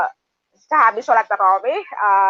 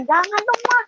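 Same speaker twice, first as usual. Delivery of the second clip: hoped-for protest; 10 semitones higher than usual. Retold or imitated. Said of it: "Saya berharap anak saya melarang".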